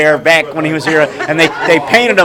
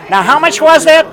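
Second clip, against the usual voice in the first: customer lady voice; 9 semitones higher than usual. Retold or imitated. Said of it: "she says".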